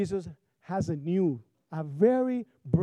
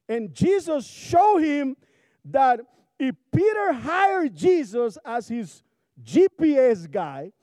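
slow, deliberate preaching that builds louder; emphatic preaching; 8 semitones higher, 8 dB louder than usual. Acted. Pastor to congregation, slow emphatic preaching. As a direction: lay it out word by word for the congregation.

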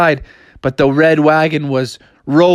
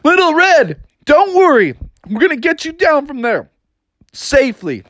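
calling up to the wagon; 11 semitones higher than usual. Acted. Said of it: "they shouted to the wagon".

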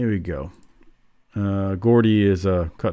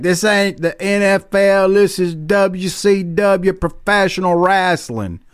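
higher, slow wrestler promo imitation; Terry Gordy voice; 10 semitones higher, slower than usual. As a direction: ham up a brawler's promo, drawn out. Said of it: "And Gordy's like".

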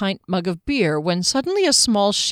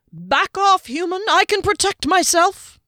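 much higher squeaky little voice, bravely indignant; defiant halfling; 12 semitones higher, 4 dB louder than usual. Acted. Halfling defying Juggler's blade, much higher and indignant. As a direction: puff yourself up like a tiny hero. Halfling defies armed human.

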